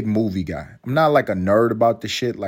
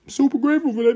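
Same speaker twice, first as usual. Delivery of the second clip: silly high voice; 3 dB louder, faster than usual. Pretend. Echoing his own gratitude, silly.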